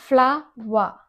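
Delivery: ఫ్లవర్ stretched into fla, wa; slow teaching model of ఫ్లవర్, R silent; syllable-by-syllable. Shown as a demonstration. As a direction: draw out both halves of ఫ్లవర్. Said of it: "అది ఫ్లోర్ కాదు".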